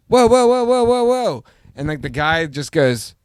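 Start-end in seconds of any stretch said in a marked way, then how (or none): 0.1-1.4 high loud shouted warning; alarmed yelling. Retold or imitated. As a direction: yell it to stop him, alarmed. Yelling warning at old man.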